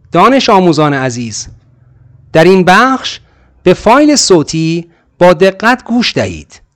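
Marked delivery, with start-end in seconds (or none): none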